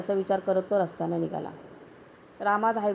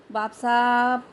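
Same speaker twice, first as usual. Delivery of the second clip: loud call into the house, a little higher; 3 semitones higher, 4 dB louder, much slower than usual. A narrator's reading. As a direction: call the master from outside the door. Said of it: "आत बघत हाली दिली". (बाहेरून मोठ्यानं हाक)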